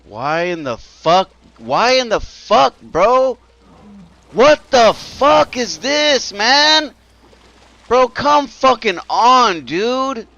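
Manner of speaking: raging shout, high and loud; furious yelling. Real, not acted. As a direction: scream it out in frustration. Gamer raging at game deaths.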